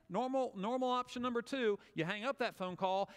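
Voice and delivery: ordinary delivery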